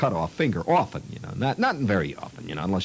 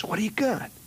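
put-on high, pushy boy's question; eager kid; 13 semitones higher than usual. Curious kid demanding to see the box, high and eager. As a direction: pester like a curious kid, pitched up. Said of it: "He says".

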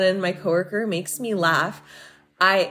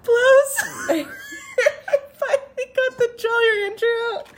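very high, squealed through laughter; laughing hard. Real, not acted. Telling how the candy came out, helplessly laughing. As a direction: barely get it out while cracking up. Cracking up at choking story's climax.

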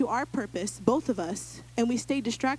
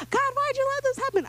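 mock whine. Mocking people whining at God.

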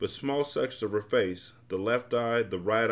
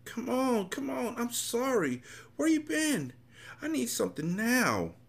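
put-on whining child. A narrator's reading. Desperate mother begging at the door, high childlike whine. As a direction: whine it very high, drawing the words out. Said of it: "coming out like a whining child".

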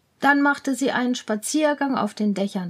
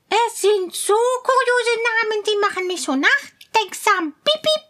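high, louder mouse voice; pensive mouse; 11 semitones higher, 4 dB louder than usual. Acted. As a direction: muse squeakily like a mouse. Mouse ponders.

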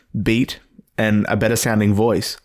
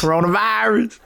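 mock high echo; 10 semitones higher than usual.

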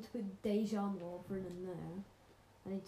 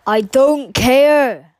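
loud grumble; 25 dB louder, much slower than usual. Real, not acted. Brother grumbles at the camera.

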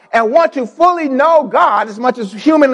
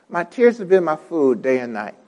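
quiet reflection; 8 semitones lower, 6 dB quieter than usual. Acted.

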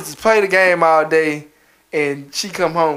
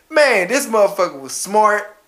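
normal talking voice

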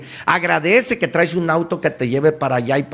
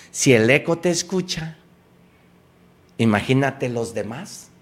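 ordinary delivery

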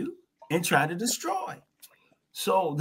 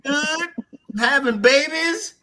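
slow, amused, higher and louder; laughing; 6 semitones higher, 4 dB louder, much slower than usual. Real, not acted. Describing the contented mice while laughing. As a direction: chuckle through it.

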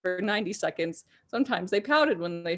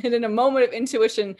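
ordinary delivery

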